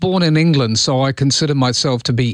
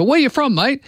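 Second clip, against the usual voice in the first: higher-pitched matey question, re-enacted; chatty passenger's question; 8 semitones higher than usual. Passenger asking the taxi driver where he's from, higher and matey. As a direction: imitate a casual opener to a cabbie.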